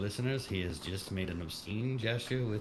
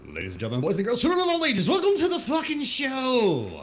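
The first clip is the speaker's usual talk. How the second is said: booming ringmaster welcome, higher and louder; grand entrance announcement; 9 dB louder than usual. Acted. Podcast host opening the show.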